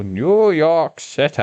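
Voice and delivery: a bad, hammed-up Matt Berry on New York City; Matt Berry impression. Shown as a demonstration. Gamer wishing he could do Matt Berry, badly faked impression. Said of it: "that was a horrible impersonation".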